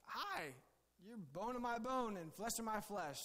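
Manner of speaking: Adam meeting Eve and ineptly being sensitive; mock-sensitive Adam. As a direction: say it like a clumsy guy trying to be tender. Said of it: "real sensitive Adam".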